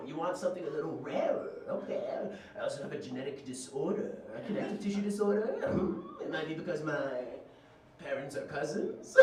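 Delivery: lightly voiced cartoon dealer; a hint of old-timey street dealer; shady drug dealer. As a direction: offer rare goods like a shady peddler. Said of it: "like, shady drug dealer".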